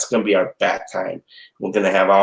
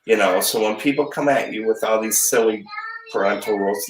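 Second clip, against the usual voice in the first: normal talking voice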